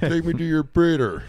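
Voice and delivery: high, loud booming alien command; booming arrogant alien overlord. Pretend. Continues alien voice.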